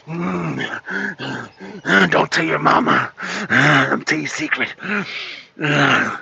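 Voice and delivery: mock creepy confiding in a deep voice with grunts; creepy old politician. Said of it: "told a woman in front".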